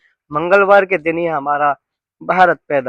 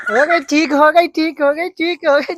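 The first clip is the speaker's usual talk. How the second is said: high, cooing reassurance; high singsong all better now; soothing a hurt child; 10 semitones higher, 3 dB louder than usual. Acted. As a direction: coo it over and over to calm the little one. Soothing the child after a fall.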